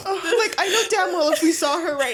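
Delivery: high-pitched, helpless laughter; tearful giggly protest; laughing through tears. Real, not acted. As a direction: talk on while cracking up. Laughing while tearful about mouse.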